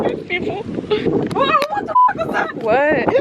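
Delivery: high-pitched shocked yelp; suddenly spooked, voice jumps up; startled shriek. Real, not acted. Something strange nearby on beach.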